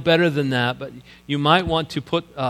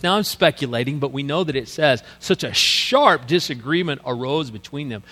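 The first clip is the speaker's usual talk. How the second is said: ordinary talk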